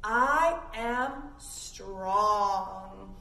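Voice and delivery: very slow, strong affirmation; drawn-out, firm statement. Shown as a demonstration. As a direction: stretch it out like a pledge. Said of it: "Big smell".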